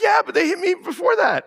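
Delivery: put-on high whiny kid excuse; child voice. Child excusing himself to dad, high and whiny. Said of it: "I said".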